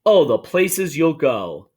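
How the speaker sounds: usual speaking voice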